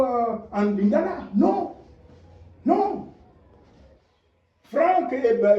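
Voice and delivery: high, loud testimony with fervor; impassioned preaching. Acted. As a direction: proclaim it to the whole church. (témoignage prêché avec ferveur, voix haute et forte)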